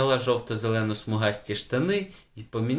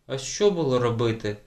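usual speaking voice

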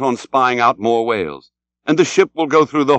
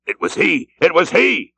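excited cry, growing more insistent; Ahab crying out; 6 semitones higher than usual. A narrator's reading. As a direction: burst out on released breath, rising on the repeat. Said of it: "cried Ahab, suddenly letting out his suspended breath".

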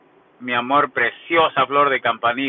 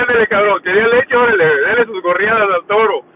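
higher and louder teasing jab, hammed-up; mock taunting Carla; 6 semitones higher, 5 dB louder than usual. Carla taunting Gerson, a hammed-up loud higher jeer. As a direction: bark it like a mocking woman taunting him. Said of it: "Y le decía a Gerson la matraca".

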